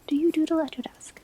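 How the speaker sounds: ordinary talk, nothing marked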